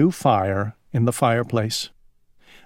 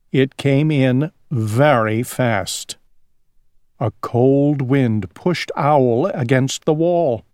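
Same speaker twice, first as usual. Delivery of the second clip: usual speaking voice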